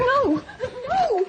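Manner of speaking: high, loud cries of panic; terrified refusal. Acted. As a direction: cry out in terror, pitch leaping up. Girl being forced inside by man.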